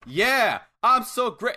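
high, loud cocky gloat; smug boast. Retold or imitated. Imitating the heel gloating after ducking, high and loud. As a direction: crow it like an arrogant heel. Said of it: "he's like".

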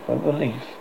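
ordinary delivery

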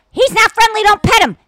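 high, loud warning; warning shout. Retold or imitated. Owner warning passersby off a biting dog, loud. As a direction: shout strangers away from the dog. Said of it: "I'd have to say".

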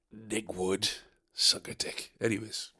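usual speaking voice

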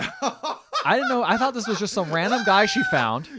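laughing it out, pitched high and loud. Real, not acted. Cracking up to her co-host over the twist, laughing loud and high. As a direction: crack up while shouting the disbelief.

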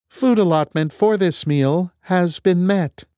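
high, slow machine voice; computer voice. Acted. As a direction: recite it like a cold computer. Computer voice answers.

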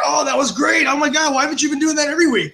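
high, loud, fast mock gushing; gushing praise. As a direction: mimic the writer's giddy relief. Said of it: "we came back and like".

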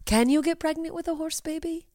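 high, soft put-on voice; mock earnest question. Host acting out the typed note, in a high, soft mock voice. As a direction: ask it small and high, mocking the question. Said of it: "as if I was supposed to be like".

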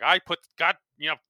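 loud, frustrated boss rant; exasperated GM. Retold or imitated. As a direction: sputter like an annoyed executive. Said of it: "it's such a funny instinct to be like".

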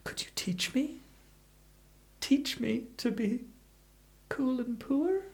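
soft, high pleading whisper. Acted. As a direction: beg him softly for help. Begging to be taught poverty.

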